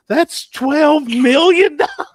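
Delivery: high, loud, slow grand announcement; mock announcer. Imitating the announcer on the mic, high, slow and loud. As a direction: proclaim the total like a game show host. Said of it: "he said".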